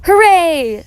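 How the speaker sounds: high, loud hooray; joyful cheer. A narrator's reading. Baby Bear cheers for the tree, joyful cheer. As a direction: let out a little one's cheer.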